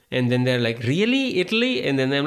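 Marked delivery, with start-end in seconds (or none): 0.8-1.8 high, skeptical "Really? Italy?"; mock disbelief. Stranger questioning his claim to be from Italy, high mock disbelief. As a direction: play the stranger's doubtful surprise.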